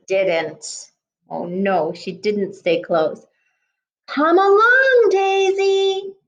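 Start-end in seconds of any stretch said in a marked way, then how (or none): 4.1-6.1 high, loud mother duck call. Acted. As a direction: call her back across the water, high and loud. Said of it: "called Mama Duck".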